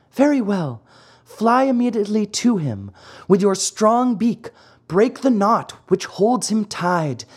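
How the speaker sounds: high, light commanding fairy. Acted. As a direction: give the falcon orders kindly.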